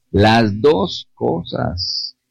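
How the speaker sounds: slow, drawn-out "Las dos cosas"; slowly hammers home "Las dos cosas"; slow emphasis. Real, not acted. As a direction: lean on each word of "Las dos cosas". Preacher drives home his point.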